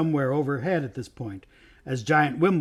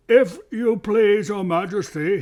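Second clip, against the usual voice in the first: thick, drowsy bear, pitched up; thick sleepy voice; 7 semitones higher, 6 dB louder, slower than usual. A narrator's reading. Old bear petitioning the king, thick sleepy voice. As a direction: plead slowly through a sleepy haze. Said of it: "sleepy voice said".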